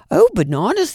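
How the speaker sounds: high, loud amazed exclamation; astonished cry. Acted. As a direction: gasp it out in amazement. Family astonished by trunk.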